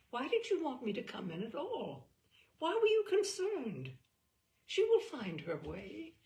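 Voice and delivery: lightly voiced daughter, rising in disbelief; a hint of a puzzled teenager; questioning daughter. Daughter asking her mother why she worried, lightly puzzled.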